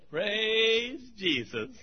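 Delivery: slow, soft, reverent murmur; quiet praise. Retold or imitated. Pastor imitating a quiet choir member answering his sermon, soft slow praise. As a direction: draw it out gently like a quiet believer. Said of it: "He'd go".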